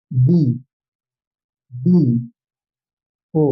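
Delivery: बी, बी, ओ said as separate letters with pauses; spelled-out letters. Shown as a demonstration. Teacher reads BDO letter by letter to students. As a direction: call out each letter of बी, बी, ओ in turn. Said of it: "ऐसे कहेंगे ना".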